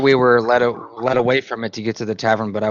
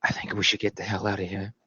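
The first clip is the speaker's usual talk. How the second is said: hushed, softened aside; whisper; 2 semitones lower, 8 dB quieter than usual. Pretend. Player urges a companion to leave, whispering. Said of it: "And I whisper".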